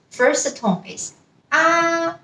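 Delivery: first tone is ah, ah high, level and slow. Shown as a demonstration. Teacher showing the first tone on ah. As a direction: hold ah flat and high. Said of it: "the pronunciation is also flat".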